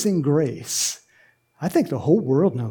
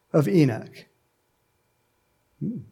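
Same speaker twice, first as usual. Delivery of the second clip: usual speaking voice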